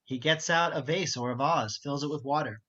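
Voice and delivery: ordinary talk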